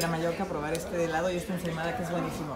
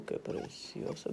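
slow, softer whispered admiration; 9 dB quieter, much slower than usual. Real, not acted. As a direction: breathe it out gently, slowly. Stroking the parrot.

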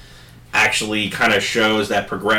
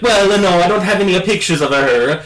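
put-on high, loud thick Italian accent; 9 semitones higher, 4 dB louder than usual. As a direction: backpedal in a Mario-like Italian accent. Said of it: "in a very Mario-like accent".

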